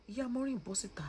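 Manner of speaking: soft, half-whispered repeat of the call; hushed summons. Real, not acted. Vlogger asking Maureen's mother to come out, hushed summons. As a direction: drop to a quiet, breath-light plea.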